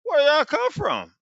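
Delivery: high, slow startled question; surprised. Acted. Abraham asking the angels where they came from, high and startled.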